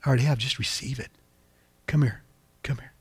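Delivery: soft, hushed invitation; gentle heavenly Father. Acted. God answering a child who asks for blessing, tender and soft. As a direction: speak as God, drawing a child close. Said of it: "And he says".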